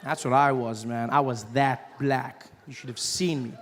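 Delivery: ordinary talk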